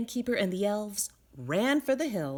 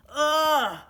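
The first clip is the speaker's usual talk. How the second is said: loud, higher groan of disgust; disgusted ugh; 6 semitones higher, 8 dB louder than usual. A narrator's reading. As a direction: innkeeper recoils in disgust. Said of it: "said the innkeeper".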